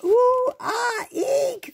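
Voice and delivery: very high, loud frightened shrieks; wild shriek. Acted. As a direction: shriek wildly in panic. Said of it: "they shrieked wildly".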